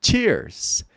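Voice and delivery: Cheers said slowly and high, with a clear ch start; model word cheers. Shown as a demonstration. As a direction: Cheers with the ch of tchau. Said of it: "em uma palavra como cheers".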